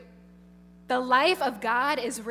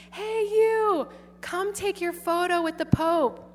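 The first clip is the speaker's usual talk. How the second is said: high, bright hailing voice, pitched up; eager calling out; 6 semitones higher than usual. Retold or imitated. Nun hailing a passing girl, eager call. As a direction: hail the girl across the hall.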